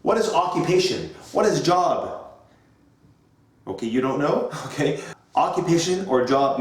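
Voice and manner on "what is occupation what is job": classroom teacher voice. Shown as a demonstration. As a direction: address the class like young learners.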